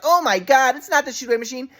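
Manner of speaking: high-pitched mock exasperation; exasperated mom imitation. Son retelling mom's dismissal, high exasperated mimicry. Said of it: "And she's like".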